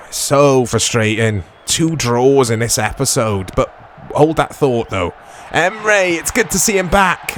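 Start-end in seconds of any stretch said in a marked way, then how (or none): none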